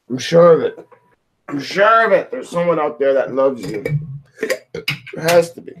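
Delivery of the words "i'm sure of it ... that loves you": emphatic reassurance. Real, not acted. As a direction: say it like you mean it, pushing harder.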